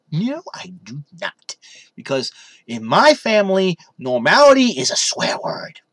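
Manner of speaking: ordinary delivery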